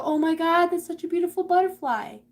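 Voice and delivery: high, loud gush of admiration; acted awe, pitched well up; admiring onlooker. Voicing onlookers admiring a butterfly.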